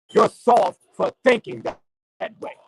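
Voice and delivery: impassioned street preacher rant; fired-up preaching. Real, not acted. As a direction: rail at the listeners like a pulpit sermon.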